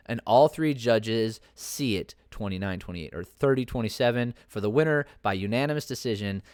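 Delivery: ordinary talk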